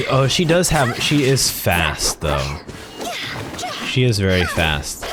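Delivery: ordinary talk, nothing marked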